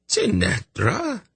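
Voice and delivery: high, slow surprised question; startled old man calling her name. Acted. Gorim surprised, slow rise.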